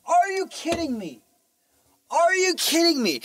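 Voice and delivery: high-pitched, hammed-up incredulity; outraged disbelief. Man confronting the client over the drink, outraged disbelief. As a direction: demand it in a high, indignant cry.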